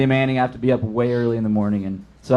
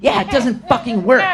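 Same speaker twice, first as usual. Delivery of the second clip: high-pitched indignant retort; mock irate girl; 11 semitones higher than usual. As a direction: imitate a customer snapping back. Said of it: "this girl screaming".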